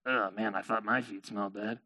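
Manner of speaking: low, very soft groaning complaint; disgusted grumble. Acted. Merchant beside smelly camel legs, grumbling. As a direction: grumble it sleepily to yourself.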